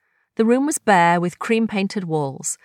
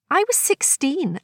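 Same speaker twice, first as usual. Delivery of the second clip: higher voice, wistful surprise; Agnes, nostalgic; 9 semitones higher than usual. Acted. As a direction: recall a long-lost memory. Agnes recalling youth.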